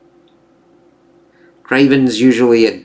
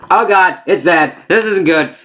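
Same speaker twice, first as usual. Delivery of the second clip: pitched-up hammed-up panic; mock alarm; 7 semitones higher than usual. Peter feeling the spikes go wrong, mock panicked. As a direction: play a hero suddenly realising it hurts.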